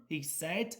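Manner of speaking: ordinary delivery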